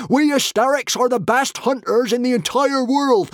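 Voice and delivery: high, loud outraged screaming; shrieking high-pitched warrior rage; yelling. A narrator's reading. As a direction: bellow threats in hysterical fury. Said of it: "yelled the hysteric with the axe".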